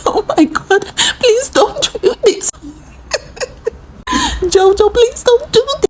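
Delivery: high, slow mock crying plea; imitating a friend wailing please don't; hammed-up pleading wail. Friend begging Jojo not to leave.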